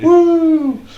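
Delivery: high, loud whoop; excited cheer. Real, not acted. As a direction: whoop with joy. Cheering about Highlands events.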